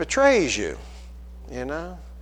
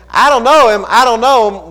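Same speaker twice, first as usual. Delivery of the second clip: loud, forceful denial; Peter's loud denial; 6 semitones higher, 8 dB louder than usual. Acted. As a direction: Peter brushing off his accusers, loud. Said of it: "when Peter starts saying".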